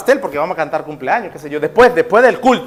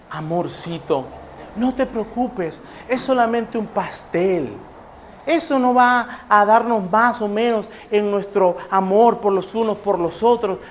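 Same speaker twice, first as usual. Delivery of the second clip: soft, slow soothing of a crying friend; tender comforting; 7 dB quieter than usual. Acted. Sister Reina consoling Sofía over the cake, soft and tender. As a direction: gently reassure her it's only a cake.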